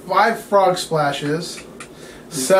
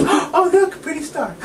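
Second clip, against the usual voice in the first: much higher, dreamy and giggling; dazed referee; 11 semitones higher than usual. Retold or imitated. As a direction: play the dizzy referee seeing stars. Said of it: "looks back up at the sky and goes".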